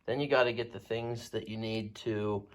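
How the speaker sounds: usual speaking voice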